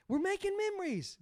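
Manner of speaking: high, loud yell of a sideline dad. Retold or imitated. Dad yelling at his son from the sideline. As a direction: shout it from the sideline like a mad dad. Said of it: "I'm yelling it".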